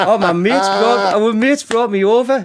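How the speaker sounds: high, loud, drawn-out mock reply; chatty lad imitation. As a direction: imitate an unsuspecting lad, pitched right up. Acting lad answering pub owner.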